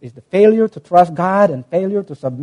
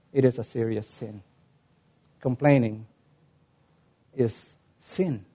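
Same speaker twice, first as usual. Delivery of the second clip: hushed solemn; 6 semitones lower, 14 dB quieter than usual. Real, not acted. Solemn point in sermon.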